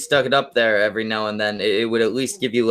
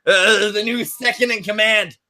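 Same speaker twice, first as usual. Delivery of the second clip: mock proclamation; 8 semitones higher than usual. Announcing the new rank.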